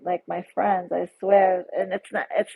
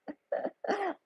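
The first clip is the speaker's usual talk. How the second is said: high burst of delighted laughter; a sudden high giggle; real laugh; 11 semitones higher, 9 dB quieter than usual. Real, not acted. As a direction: laugh out, tickled. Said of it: "I'm screaming".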